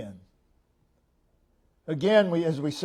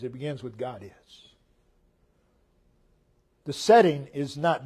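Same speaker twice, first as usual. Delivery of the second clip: ordinary delivery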